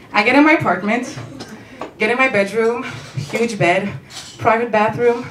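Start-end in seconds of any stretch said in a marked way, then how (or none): none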